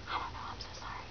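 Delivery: sheepish whispered apology under her breath. Real, not acted. A booktuber whispers a sorry to the author, whispering.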